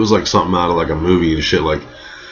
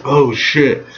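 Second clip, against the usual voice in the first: oh shit surprise; 7 semitones higher than usual. Retold or imitated. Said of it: "I was just like".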